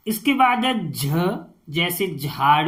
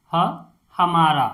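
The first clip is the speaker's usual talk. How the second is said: ordinary talk, nothing marked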